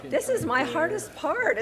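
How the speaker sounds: embarrassed little laugh. Real, not acted. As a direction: laugh it off, a bit sheepish. Embarrassed about forgetting names.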